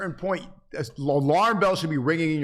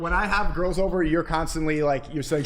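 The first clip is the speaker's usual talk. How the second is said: ordinary talk, nothing marked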